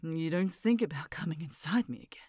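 normal talking voice

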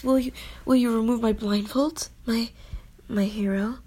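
ordinary talk

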